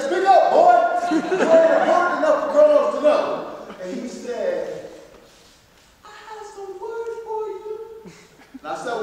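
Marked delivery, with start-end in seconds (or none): 0.1-3.2 put-on loud, higher barking at the boy; gruff bellow. Kronos shouting down at little Zeus. 6.1-7.7 put-on high, soft child's voice; tiny sweet boy calling out; pretty little voice. Little Zeus calling Kronos, high and soft. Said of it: "with his pretty little voice".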